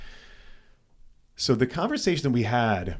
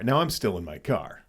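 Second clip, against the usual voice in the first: normal talking voice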